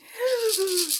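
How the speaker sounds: sharp loud gasp; storyteller's gasp. Acted. Teacher gasping as the freezing grasshopper. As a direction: gasp at the cold.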